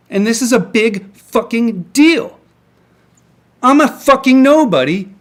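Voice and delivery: venting real fury on camera; angry rant. Real, not acted. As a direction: let the anger boil over, shouting the questions. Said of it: "I'm sorry, I'm angry".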